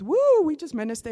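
high, loud burst of praise; joyful whoop. Real, not acted. Speaker to the church, recalling the bond, joyful whoop. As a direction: let the joy burst out.

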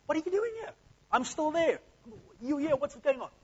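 mock incredulous God. Preacher jokingly voicing God baffled at Elijah hiding, high and mock incredulous. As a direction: shriek it like God can't believe it. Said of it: "God didn't say that".

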